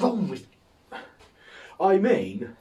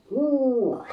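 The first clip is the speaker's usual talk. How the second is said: bemused bubble man; 12 semitones higher, 4 dB louder than usual. Retold or imitated. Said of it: "he just sort of stood there like".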